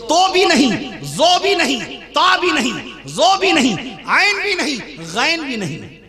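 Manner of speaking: high rhythmic list, each phrase falling; preaching at high pitch. Real, not acted. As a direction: call out each item like a preacher.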